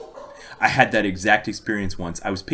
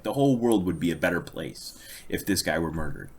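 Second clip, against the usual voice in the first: ordinary talk, nothing marked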